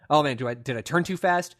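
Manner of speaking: normal talking voice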